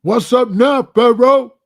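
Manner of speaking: loud, high jeering call across the cell; mocking taunt. Cellmates jeering at the lone vice lord, loud mocking taunt. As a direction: rub it in, taunting him.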